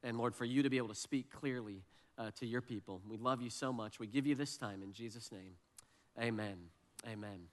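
low, softened praying voice; prayer. Real, not acted. Pastor praying to God for his people, low and quiet. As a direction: speak to God gently and low.

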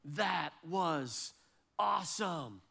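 drawn-out, weighty delivery with pauses; imitating his awed son. Son to his dad on the ski trip, mock awe. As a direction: voice a son impressed by his dad, pausing between words. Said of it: "my son looks at me and he goes".

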